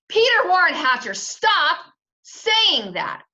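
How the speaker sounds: mother hollering at her son. Acted. Mother scolding Peter by full name, hollering. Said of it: "Mom hollered".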